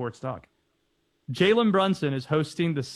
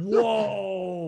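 surprised shout; 4 semitones higher than usual. Real, not acted. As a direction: shout it in shock. Surprised at the suggestion.

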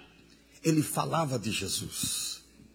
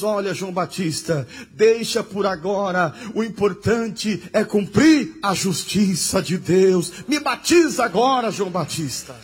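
Jesus answering John; 4 semitones higher, 6 dB louder than usual. Acted. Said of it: "Jesus diz".

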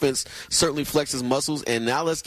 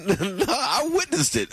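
laughing through the words; amused, giggling disbelief; 11 semitones higher than usual. Real, not acted. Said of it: "with a smile on your face".

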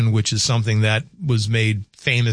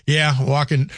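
ordinary talk

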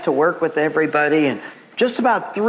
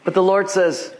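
usual speaking voice

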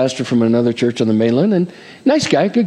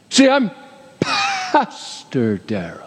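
self-important pastor; 9 semitones higher, slower than usual. Acted. Preacher parodying himself flaunting his title, pompous drawl. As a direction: stretch out the title as mock grandeur.

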